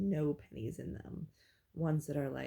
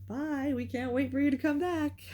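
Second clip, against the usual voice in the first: higher, louder sing-song goodbye with a laugh; gushing farewell; 7 semitones higher, 5 dB louder than usual. Retold or imitated. Dancers seeing her off after tips, gushing and laughing. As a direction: sweet-talk a favourite customer out the door.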